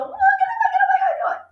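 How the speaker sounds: high panicky get away; frightened shooing. Retold or imitated. Teacher acting out chasing off a dog with a stick. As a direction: wave them off in alarm. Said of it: "you have a stick and you go".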